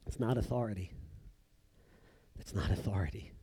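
very soft, low, gentle correction; the Lord, gently. Acted. The Lord gently correcting Ananias, soft and low. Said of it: "very gently and nicely says".

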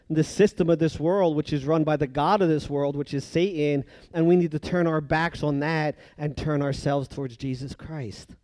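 ordinary delivery

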